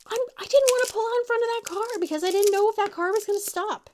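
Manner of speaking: high, loud, fast justification; defensive protest. Retold or imitated. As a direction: rush out the excuse, defending yourself. Son defending his hesitation.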